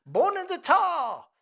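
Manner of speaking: loud, high Italian-American exclamation; Italian-American accent. Retold or imitated. Mock Italian-American accent, shouting the song's outro. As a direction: belt it out like an Italian-American crooner. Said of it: "my imitation Italian New Jersey accent".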